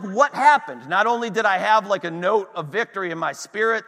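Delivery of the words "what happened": loud, higher, baffled outburst; thrown-back disbelief. Retold or imitated. Preacher recalls his shock at the bad report, baffled and loud. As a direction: reenact being stunned, pitched up. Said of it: "I'm thrown back at this point".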